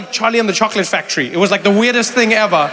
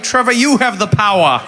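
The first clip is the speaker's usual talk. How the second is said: loud, raised proclamation voice; mock grand pronouncement; 3 semitones higher, 6 dB louder than usual. Audience member granting Trevor control, mock-grand and loud. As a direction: bestow it like a mighty title. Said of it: "I thought you were like".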